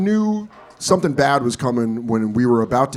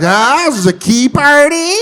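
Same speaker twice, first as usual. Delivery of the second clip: put-on high, loud, cocky kid swinger; little swinger; 11 semitones higher, 6 dB louder than usual. Eight-year-old crashing a girls' party, mock swinger. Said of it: "like a little swinger".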